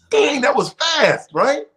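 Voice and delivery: high, slow, drawn-out amazement; amazed. Retold or imitated. Newcomer timing singers in the room, slow amazed voice. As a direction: stretch out the disbelief. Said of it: "It's like".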